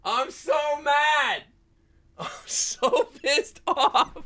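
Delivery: very high-pitched, drawn-out cry of outrage; real meltdown, voice shooting way up; exasperated wailing. Real, not acted. Streamer raging at a silly puzzle answer, exasperated wail.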